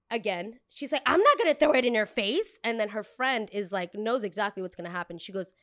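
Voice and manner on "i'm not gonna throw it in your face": high, loud dismissive customer; entitled customer. Retold or imitated. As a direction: snap it like a rude customer. Customer voiced.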